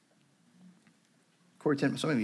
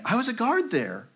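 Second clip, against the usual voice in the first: much higher-pitched voiced guard; former camp guard; 12 semitones higher than usual. A narrator's reading. Guard telling survivor of his past, high-voiced guard. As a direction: play the guard with pitch lifted well up. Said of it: "he was saying".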